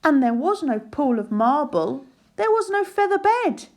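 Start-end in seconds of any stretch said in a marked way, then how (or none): none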